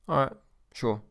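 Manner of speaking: unemotional, brief acknowledgement; businesslike, no excitement; blunt. Shown as a demonstration. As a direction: take the answer without any reaction. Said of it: "don't show any emotion".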